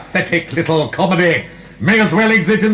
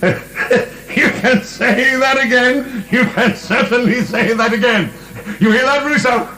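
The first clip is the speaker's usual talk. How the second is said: laughing; 5 semitones higher than usual. Acted. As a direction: laugh scornfully, drawing it out. Prisoner overhearing, mocking.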